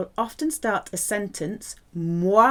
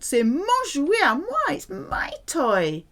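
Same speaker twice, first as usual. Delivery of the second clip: put-on high, swooping spoilt-child protest; 7 semitones higher than usual. Shown as a demonstration. Teacher imitating a child claiming a toy, spoilt and possessive. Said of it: "Sounds a bit like a spoilt child".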